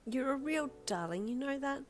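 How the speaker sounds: firmly. Acted. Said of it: "she stated firmly".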